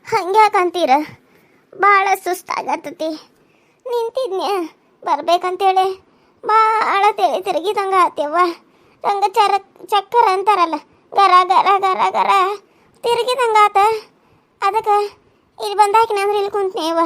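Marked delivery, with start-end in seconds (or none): 1.7-8.4 weary, dizzy. Acted. Old woman complains of dizziness. 11.1-12.3 spinning noise. Acted. Mimics the spinning dizziness.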